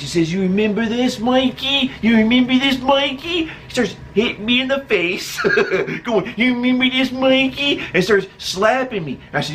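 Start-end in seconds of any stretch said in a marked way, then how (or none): 0.3-3.4 high, sing-out taunt on the name; pitched-up teasing challenge; mocking bully taunt. Imitating childhood bully's taunts. 6.4-7.8 high, sing-out taunt on the name; pitched-up teasing challenge; mocking bully taunt. Bully taunting while slapping.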